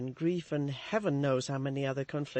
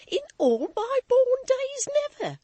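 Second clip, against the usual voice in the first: high, loud, emotional servant; hysterical maid; 9 dB louder than usual. A narrator's reading. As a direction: overwrought and laughing. Susan voiced, hysterical.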